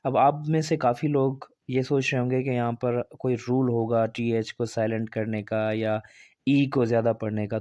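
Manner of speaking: normal talking voice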